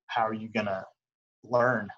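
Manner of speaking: normal talking voice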